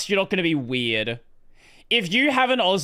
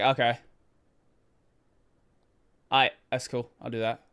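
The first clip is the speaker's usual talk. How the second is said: flat compliance; 7 semitones lower, 4 dB quieter than usual. Retold or imitated. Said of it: "I'm like".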